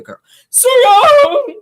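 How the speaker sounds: put-on high, slow, cried-out Sue Young; imitating the daddy. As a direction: wail the name like the daddy did. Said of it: "how the daddy said it".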